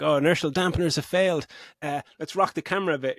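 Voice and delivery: hammed-up starship panic; mock Star Trek crew. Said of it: "people are like".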